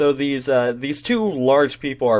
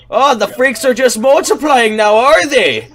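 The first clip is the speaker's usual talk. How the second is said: high, loud shout from a crowd; loud taunt called across a room; heckling yell; 12 semitones higher, 8 dB louder than usual. Acted. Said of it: "crowd of people yell out".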